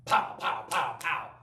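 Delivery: punchy spoken pounding noises; imitated banging on a door. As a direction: pound out each blow with the voice. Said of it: "I hear this pound".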